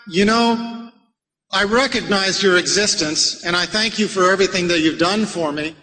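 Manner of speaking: ordinary talk, nothing marked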